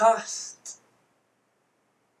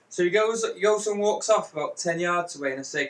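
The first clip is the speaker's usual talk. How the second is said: normal talking voice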